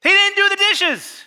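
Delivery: high, loud, slow punchline; indignant. Real, not acted. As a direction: land the outrage big. Punchline reveal.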